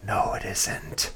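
slow, flat, whispered put-down; deadpan whisper. Retold or imitated. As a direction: drop it quietly, slowly, like a dry reality check. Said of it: "And it's like".

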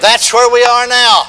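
loud, high, very slow call for the crowd to repeat; preaching call. Acted. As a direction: chant it out line by line. Call and response.

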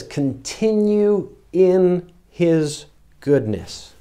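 slow, weighty "continue in his goodness" with "continue" leaned on; stressed phrase. Shown as a demonstration. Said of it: "Notice this".

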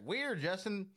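high, loud, pointed questioning; hammed-up incredulous accuser; mock interrogation. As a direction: feign suspicion as you confront the obvious culprit. Playing someone questioning the killer.

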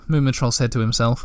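usual speaking voice

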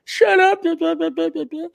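very high-pitched jeering yammer; mock shrill interruption. Talking over someone trying to build bridges, high mocking yammer. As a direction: drown them out in a squeaky high voice.

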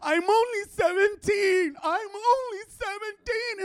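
mock teen wail, very high and drawn out; whining teenage girl. Daughter protesting to a grown man, mock whiny wail.

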